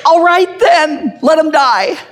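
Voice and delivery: high, slow hammed-up exclamation; mock triumphant cry. Parent hears he said Jesus, mock triumphant cry. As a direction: proclaim it high with mock relief. Acts out the mistaken parent's answer.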